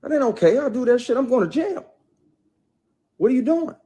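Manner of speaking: high, loud retold yell of alarm. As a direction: shout it like you're checking her hard. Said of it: "checked her, like".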